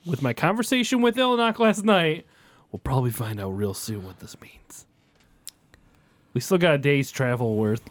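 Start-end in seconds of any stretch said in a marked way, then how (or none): 2.7-4.9 unvoiced whisper, ominous; hushed whisper. Real, not acted. Cleric hints about his talk with Ilnok in a hushed whisper. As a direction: drop to a whisper for the hint.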